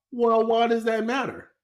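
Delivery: high, loud mocking voice of the average person; mock naive question. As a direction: play dumb, squeaky and incredulous. Mocking what most people ask.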